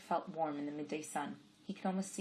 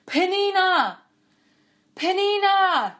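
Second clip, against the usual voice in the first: loud, high, slow repeated calls; calling out; 17 dB louder, much slower than usual. A narrator's reading. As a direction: keep calling her name as he runs. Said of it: "calling out".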